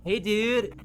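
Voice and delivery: lazy stoner greeting. Acted. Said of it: "doing more in Stoner Voice today".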